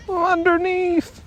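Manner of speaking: very high, slow, small voice from below; underneath sort of way. Acted. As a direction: sound muffled and squashed under someone. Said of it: "in an underneath sort of way".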